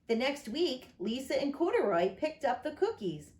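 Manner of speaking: plain reading voice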